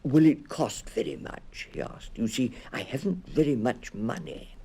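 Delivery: small bear fretting about money; worried bear. A narrator's reading. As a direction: ask anxiously, afraid of the cost. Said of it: "A worried expression came over the bear's face".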